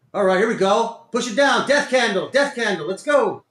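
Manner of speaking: real excited calling out; keyed-up urging. Real, not acted. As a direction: cheer the market on urgently. Cheering the price downward.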